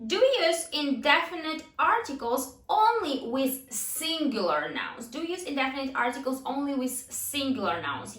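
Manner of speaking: emphatic classroom question and answer; language drill delivery. Shown as a demonstration. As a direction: read out the drill with every word set apart.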